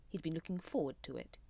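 ordinary delivery, nothing marked

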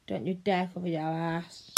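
normal talking voice

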